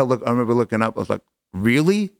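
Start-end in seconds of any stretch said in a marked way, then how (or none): 1.5-2.1 higher, rising disbelief; retold "you can't be serious" tone; incredulous. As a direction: look up and question it in disbelief. Retold reaction to the hit car.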